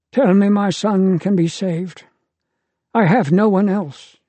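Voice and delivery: a father pleading for his only son; desperate plea. A narrator's reading. Father begs Uriel for son.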